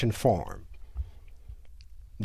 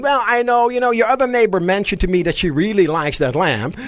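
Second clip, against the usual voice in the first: fake polite excuse; 5 semitones higher, 4 dB louder than usual. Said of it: "rather than saying".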